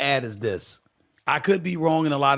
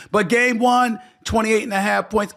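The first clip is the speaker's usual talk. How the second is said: emphatic; 8 semitones higher, 6 dB louder than usual. Real, not acted. Emphatic pick announcement.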